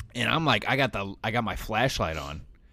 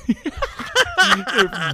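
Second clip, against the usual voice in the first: big burst of loud high laughter; 11 dB louder than usual. Real, not acted. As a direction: crack up loudly.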